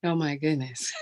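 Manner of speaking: usual speaking voice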